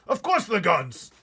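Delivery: high, loud frightened cry; a blustering general in a panic; terrified army chief. Acted. Army head crying out from the back seat, terrified. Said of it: "shouted the head of the army".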